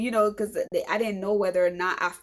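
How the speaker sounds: ordinary delivery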